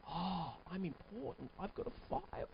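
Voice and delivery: soft, half-whispered awe; Henry impressed. Acted. Henry to himself, softly impressed by the doctor's file. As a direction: murmur like a flattered patient. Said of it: "I take out a file".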